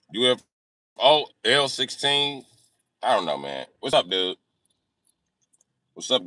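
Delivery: ordinary talk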